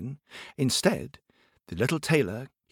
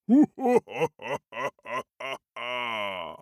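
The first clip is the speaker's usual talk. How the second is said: giant laugh. Acted. Giant laughing at the tiny tailor's challenge. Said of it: "how funny the giant thought".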